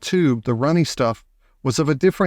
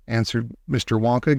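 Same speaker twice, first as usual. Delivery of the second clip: usual speaking voice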